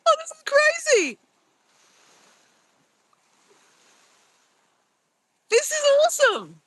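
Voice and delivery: high-pitched filter voice; squeaky chipmunk app voice. Real, not acted. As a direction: voice changed by a phone filter.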